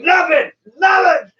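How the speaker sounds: high, loud hasty denial; John's denial. Retold or imitated. John answering his wife about the chainsaw, high shout. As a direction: shout it high, brushing her off. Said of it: "He's like".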